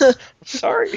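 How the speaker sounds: tiny, very high-pitched sorry; high, put-on apologetic voice; mock apology. Mock apology to British critics.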